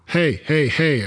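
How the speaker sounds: shout. Acted. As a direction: call out over the noise to stop the yelling. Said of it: "I shout, quieting down the crowd".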